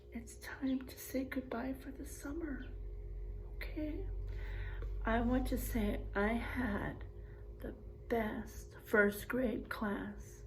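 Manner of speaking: soft, near-whispered farewell; tender goodbye. Real, not acted. Teacher softly saying goodbye to her first grade class for summer. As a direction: say goodbye gently, almost whispering.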